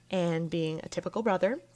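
ordinary talk

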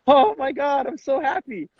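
high, gushing mock happiness; mock overjoyed. Proud dad to his player, hammed-up overjoyed.

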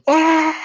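high, loud mock scream; Aang. Reviewer imitating the hero's screaming moment, high loud scream. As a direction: cry out like a falling cartoon hero. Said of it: "he just screams".

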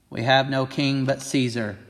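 normal talking voice

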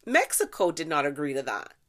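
ordinary talk, nothing marked

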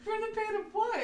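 high laughing question; asking through giggles. Real, not acted. Laughing at the synopsis.

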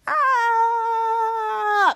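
high, sustained "aaah"; long falling scream. Retold or imitated. Kid Danger jumps into the portal, drawn-out yell. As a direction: yell all the way down.

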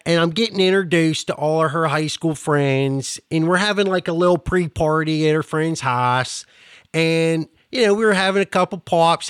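thick Pittsburghese storytelling; broad Pittsburgh accent with animated quoted voices. Shown as a demonstration. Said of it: "I'll tell you the story in Pittsburghese".

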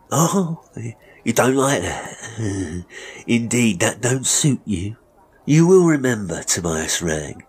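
mocking villain. Master reminding apprentice of his position, mockingly. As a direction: taunt him, then lecture with smug authority. Barber mocks the boy.

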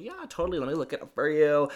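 high, increasingly loud customer-service enthusiasm; eager helpfulness. Retold or imitated. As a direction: play the eager shop helper. Acting out helping a customer.

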